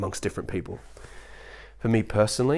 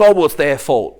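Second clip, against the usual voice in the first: louder, higher quoted objection; mock dismissiveness; 4 semitones higher, 8 dB louder than usual. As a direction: toss it out like a smug arguer. Mimicking dismissive arguer.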